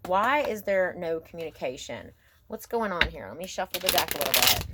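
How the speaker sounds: usual speaking voice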